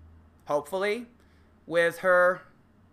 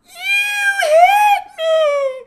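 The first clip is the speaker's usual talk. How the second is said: much higher, much louder crying shout; wailing shout; 14 dB louder, much slower than usual. Acted. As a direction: wail it like a victim. Girlfriend crying out in skit.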